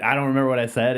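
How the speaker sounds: ordinary delivery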